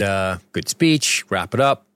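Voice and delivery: ordinary delivery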